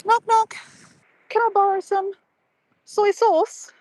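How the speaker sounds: cheery neighbour. Pretend. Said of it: "I'll be like".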